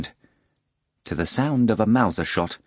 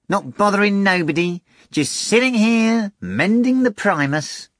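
cat voice; 11 semitones higher, 3 dB louder than usual. A narrator's reading. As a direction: play the innocent talking cat. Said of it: "said the cat".

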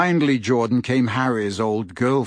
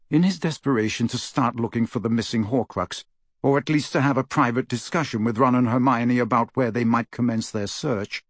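plain reading voice

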